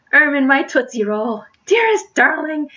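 high, loud parting endearments; rapturous lovers. Acted. Lovers part with pet names, rapturously. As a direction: cry out pet names with hammed-up passion.

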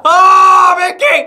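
high, loud cry of surprise; startled shout. Real, not acted. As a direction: shout the name in sudden shock. Startled by Mickey in game.

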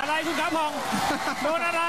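sudden startled shout; excited shout. Real, not acted. Knockdown shock ringside. (ตะโกนตื่นเต้น)

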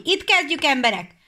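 loud, high rallying call; loud call to start the harvest. Acted. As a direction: rouse the workers loudly. Reapers calling out to start.

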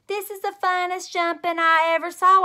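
high, loud bouncy delight; springy. Acted. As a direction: gush while jumping in the moonwalk. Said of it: "I said, very springy".

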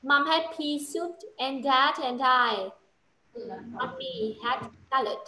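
slow, careful model line; slow correction. Shown as a demonstration. Teacher slowly models the right sentence for the pupil. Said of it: "Sai rồi".

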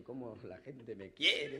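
slow, low, self-pleased; smug thief. Retold or imitated. Thief flattered by the crowd, slow and low. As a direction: savor the crowd's love, slowly. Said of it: "El tipo dice".

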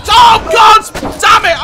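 high, loud cursing; anguished shout. Real, not acted. Gamer hit by a cannonball, loud high anguished shout. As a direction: yell out in dismay.

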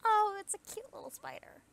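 high cooing over a cute thing. Real, not acted. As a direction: gush over something tiny and cute.

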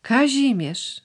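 a brother's name wrung out in shock; dismayed exclamation. Acted. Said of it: "wykrzyknął".

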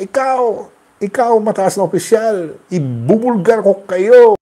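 loud, high, furious tirade; mock outburst, voice pitched high and loud; ranting threat. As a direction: rage at the officials like a man gone wild. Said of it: "nagwala si".

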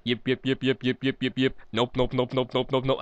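chanting alien "yip" and "nope" noises; lightly voiced alien chant. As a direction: imitate the Sesame Street aliens chanting. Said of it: "but they were like".

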